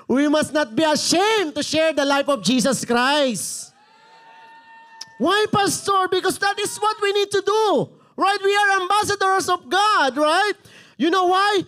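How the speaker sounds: high, loud preaching shout; impassioned pulpit yell; passionate shouting. Real, not acted. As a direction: fire it out at the congregation. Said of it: "why are you shouting, Pastor?".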